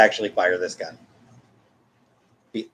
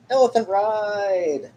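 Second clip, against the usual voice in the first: stretched out, squeaky and slow; drawn-out high playful voice; high slow silly voice; 8 semitones higher, slower than usual. Pretend. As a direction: say it like a goofy cartoon.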